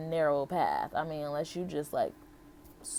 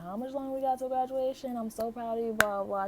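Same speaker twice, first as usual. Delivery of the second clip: lightly voiced aunt, higher and gushing; imitating proud aunt; 6 semitones higher, faster than usual. Aunt checking on her before graduation, mildly imitated higher gushing voice. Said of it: "and she's like".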